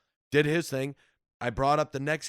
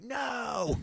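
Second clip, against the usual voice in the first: high-pitched, wailed protest; mock an old man freaking out; losing it; 13 semitones higher than usual. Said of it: "this guy's losing his shit".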